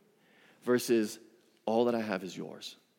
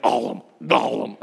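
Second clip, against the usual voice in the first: throaty gulping Gollum cough, louder; a hammed-up Gollum gulp; Gollum imitation; 3 semitones lower, 6 dB louder than usual. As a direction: cough out "Gollum, Gollum" like the creature in the caves. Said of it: "who are like".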